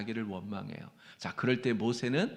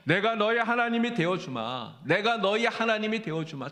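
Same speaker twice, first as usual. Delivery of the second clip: 내가 너의 하나님이 되어주마 raised high and loud, fervent; a preacher voicing God's vow, louder and higher; solemn proclaimed promise; 8 semitones higher, 4 dB louder than usual. Shown as a demonstration. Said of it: "주님 말씀하신단 말이에요".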